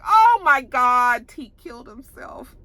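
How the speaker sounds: high, slow, stunned gasping; replaying the moment of horror for viewers; shocked disbelief. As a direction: let the shock stretch each word. Reacting to the suicide scene.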